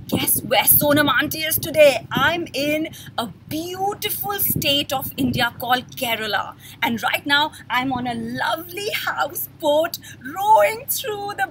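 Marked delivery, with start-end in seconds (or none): none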